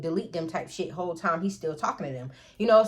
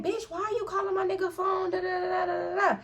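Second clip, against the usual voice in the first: high mock-angry confrontation on the phone; confrontational; 10 semitones higher, 5 dB quieter than usual. To baby mama number two on the phone, high confrontational mock anger. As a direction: go at her like you caught her. Acted phone quarrel, herself.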